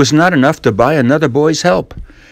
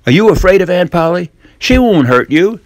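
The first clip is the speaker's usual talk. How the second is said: put-on higher, boyish coaxing voice; young Tom; 8 semitones higher, 4 dB louder than usual. Tom coaxing Jim to swap chores, sly boyish voice. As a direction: wheedle like a sly boy.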